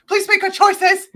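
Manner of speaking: high, loud mock-desperate plea; imitating escalating, exasperated begging; desperate pleading. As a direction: cry it out as a last appeal.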